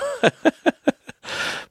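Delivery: real squealing laugh, very high. Real, not acted. Recalling waving at the driver flipping him off, high giggle. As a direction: let a high giggle burst out. Laughs at being stuck at red light.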